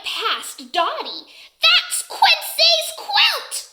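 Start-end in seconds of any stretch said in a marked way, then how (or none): 1.6-3.7 high, loud, drawn-out squawk; quacking duck. A narrator's reading. Duck spots the dog stealing her friend's quilt, quacking shout. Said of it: "quacks Dottie".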